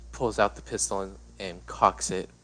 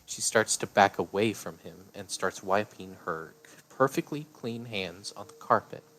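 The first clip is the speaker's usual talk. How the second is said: usual speaking voice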